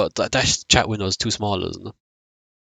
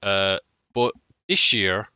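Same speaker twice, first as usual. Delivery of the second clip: glitching audio; 5 dB quieter than usual. Real, not acted. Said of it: "Your mic is cutting in and out".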